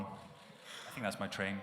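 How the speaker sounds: slow deadpan exit. Pretend. Joking exit line.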